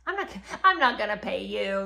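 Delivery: lightly hammed-up spoiled king refusing to pay; mock sulky ex-king. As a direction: whine like a spoiled royal. David voiced refusing to pay.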